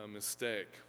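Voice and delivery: normal talking voice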